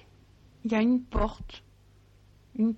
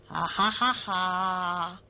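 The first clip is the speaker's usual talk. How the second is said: mocking slow laugh; 3 semitones lower, slower than usual. The red man laughs.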